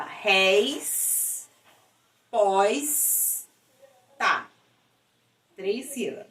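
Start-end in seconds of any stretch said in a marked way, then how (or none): 0.0-4.5 "Res, pós, tá" drawn out with gaps between; syllable by syllable. Shown as a demonstration. Teacher showing syllable separation, syllable by syllable. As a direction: hold each of "Res", "pós", "tá" apart. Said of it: "Separação de sílaba".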